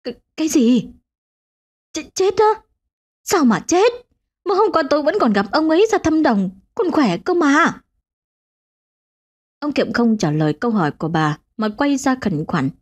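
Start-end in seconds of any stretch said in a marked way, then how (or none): 0.0-7.8 higher, shocked questions; startled disbelief. A narrator's reading. Wife reacts in shock to the neighbour's death. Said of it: "Bà giật mình thảng thốt".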